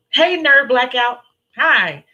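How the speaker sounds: loud, slow, high hello; excited greeting. Real, not acted. Host spotting viewer in chat, loud happy greeting. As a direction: call out a welcome.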